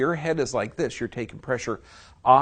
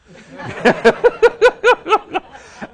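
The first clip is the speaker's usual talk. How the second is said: a high, loud burst of real laughter; big laugh; 13 dB louder than usual. Real, not acted. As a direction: crack up at your own joke. Laughs at own bar joke.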